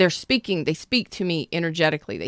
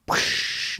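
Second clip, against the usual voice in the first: unvoiced crash noise; 6 dB quieter than usual. Imitated crash sound.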